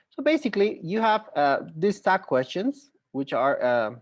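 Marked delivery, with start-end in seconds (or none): none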